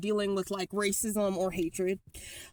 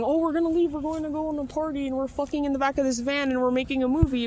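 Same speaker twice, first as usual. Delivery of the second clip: higher, mocking impression of the guys; mocking their excuse; 6 semitones higher, 3 dB louder, faster than usual. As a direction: parrot their excuse, a bit faster and higher. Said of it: "they're saying".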